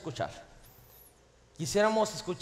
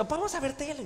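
put-on pleading child voice; 9 semitones higher, 3 dB quieter, much faster than usual. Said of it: "Y me dice".

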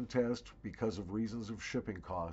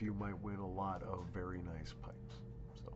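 whisper; 4 semitones lower, 7 dB quieter than usual. Acted. Teasing aside about the prize.

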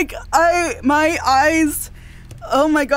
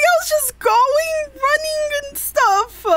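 teary; 11 semitones higher, slower than usual. Real, not acted. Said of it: "I got tears in my eyes".

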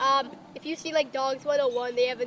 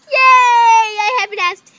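loud, slow, high shout; excited shout; 9 semitones higher, 16 dB louder, slower than usual. Real, not acted. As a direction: blurt it out loud. Excited outburst.

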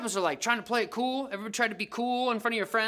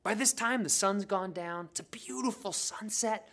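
ordinary talk, nothing marked

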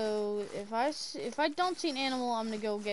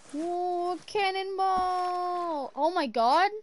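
high, slow stretched yell; cannonball yell; 6 semitones higher, 5 dB louder, slower than usual. Real, not acted. Diving into water.

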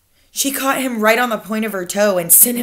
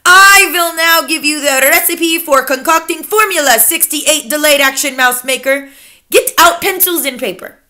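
imperious Grand High Witch; 6 semitones higher, 7 dB louder than usual. Acted. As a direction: lecture your followers with icy authority.

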